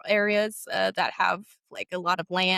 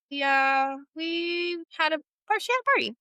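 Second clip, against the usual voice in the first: hesitant answer; 8 semitones higher than usual. Retold or imitated. Student answering her jealous teacher, high and hesitant. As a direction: stall nervously, pitch climbing.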